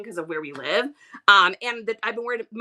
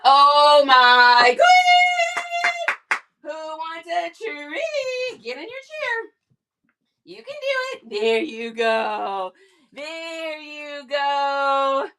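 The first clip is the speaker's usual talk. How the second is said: high, slow, sing-song coaxing; pet baby talk; 4 semitones higher, 4 dB louder, much slower than usual. Acted. To her pet while handing over a treat, cooing high.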